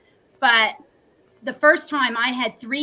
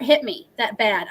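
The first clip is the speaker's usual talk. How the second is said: ordinary delivery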